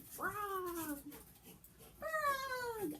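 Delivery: high mock trumpeting; high mock elephant call; elephant trumpet attempt.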